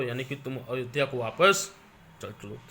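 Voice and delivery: ordinary talk, nothing marked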